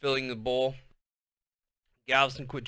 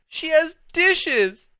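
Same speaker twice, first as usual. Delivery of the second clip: high, slow whining; whiny protest; 7 dB louder, much slower than usual. A narrator's reading. Girl whining to mother about chores, slow. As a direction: whine about fairness. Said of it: "Gal cried".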